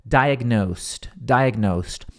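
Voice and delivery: "diagnosed" said slowly twice, ending in a T sound; final T sound. Shown as a demonstration. Teacher drills the T ending for students. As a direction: repeat "diagnosed" with a clear T at the end. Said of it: "ele soa como S T".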